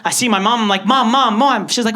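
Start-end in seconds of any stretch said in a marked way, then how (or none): 0.8-1.7 calling mom. Retold or imitated. Runs home calling his mother.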